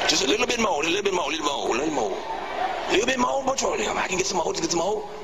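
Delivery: craving crackhead impression, broken phrases; twitchy slurred sniffing addict. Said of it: "the president of the United States sounded like a crackhead".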